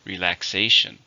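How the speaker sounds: "Relaxation" with a loud, drawn SAY; stress on third syllable. Shown as a demonstration. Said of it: "stress is on the third".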